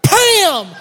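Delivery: loud high "Bam!" shouted; punch yell. Retold or imitated. Dad punching the goat away, loud high shout. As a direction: bark it as you punch. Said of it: "I was like".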